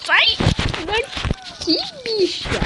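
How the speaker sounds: grito agudo e empolgado; grito animado. Real, not acted. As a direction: gritar com o inimigo no jogo. Grita com inimigo no jogo. (grito empolgado)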